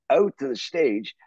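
normal talking voice

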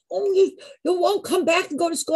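high, mock-worried parent voice; scolding parents. Parents warning their daughter off her break, mock-worried.

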